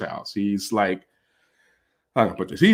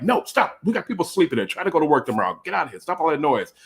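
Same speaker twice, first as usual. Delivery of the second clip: high-pitched urgent telling-off; acted-out exasperated plea, no real shouting; retold frantic scolding; 6 semitones higher, faster than usual. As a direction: pitch it up high, shooing noisy guests away. Hushing noisy guests outside at night.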